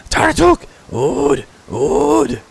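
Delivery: high, loud kobold squawk; echoing crier. Acted. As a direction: parrot it in short shrieking bursts. Crier echoes the chief's words.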